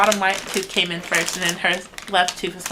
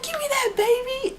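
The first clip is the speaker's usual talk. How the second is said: much higher, thrilled squeal; excited. Retold or imitated. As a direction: squeal it with pure delight. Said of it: "I was so excited".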